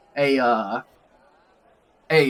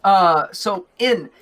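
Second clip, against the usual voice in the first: ordinary talk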